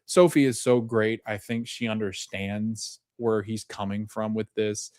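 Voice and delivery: normal talking voice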